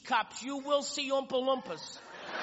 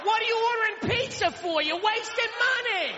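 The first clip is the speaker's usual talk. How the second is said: mock shout; 7 semitones higher than usual. Imagined beach scene, shouting.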